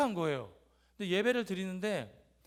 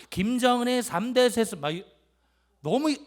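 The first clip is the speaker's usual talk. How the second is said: mimicked shout; 4 semitones higher, 8 dB louder than usual. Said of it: "막 소리를 지릅니다".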